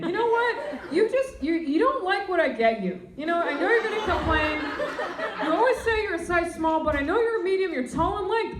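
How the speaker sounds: hammed-up furious mom; mom meltdown. Mom's Christmas meltdown, acted out.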